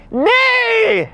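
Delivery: high-pitched drawn-out shriek of refusal; mock terrified yelp, held long and very high; imitated panicked cry. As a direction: cry out as if about to be scalded. Scared cry as water threatens.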